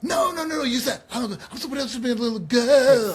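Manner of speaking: high, exasperated flamboyant correction; Dusty Rhodes. Retold or imitated. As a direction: imitate a coach overdoing the line to demonstrate. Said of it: "He goes".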